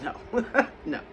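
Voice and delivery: a light laugh through the no; laughing denial. Retold or imitated. Telling a scared coworker no, with a light laugh. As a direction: chuckle it away, no harm meant.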